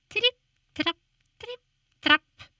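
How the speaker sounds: high, light hoof steps; high trip trap. A narrator's reading. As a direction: tap it out lightly. Goat's hooves on bridge.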